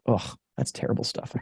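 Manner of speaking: breathy groan of a word, barely voiced; rueful whisper. Real, not acted. Speaker recalling a day of threshing rice, whispered. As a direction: whisper it, worn out just thinking of it.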